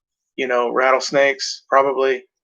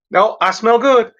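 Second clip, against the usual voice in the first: higher, louder, slow smug denial; mock self-assured; 7 semitones higher, 5 dB louder than usual. Imitating someone sure they smell good, smug. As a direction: insist you smell fine.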